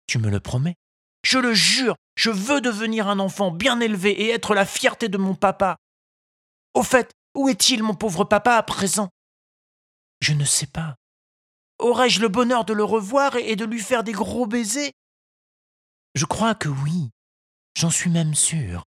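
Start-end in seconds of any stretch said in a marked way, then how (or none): 1.2-9.1 put-on high, earnest boy's voice; eager puppet boy. A narrator's reading. Pinocchio vows eagerly. 11.8-14.9 put-on high, earnest boy's voice; eager puppet boy. A narrator's reading. Pinocchio hopes for papa.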